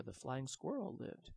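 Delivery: ordinary delivery, nothing marked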